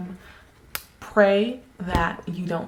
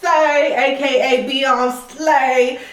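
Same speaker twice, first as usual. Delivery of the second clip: drawn-out name; 5 semitones higher, 4 dB louder, much slower than usual. Pretend. Playfully drawing out the name.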